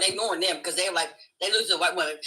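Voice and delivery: ordinary delivery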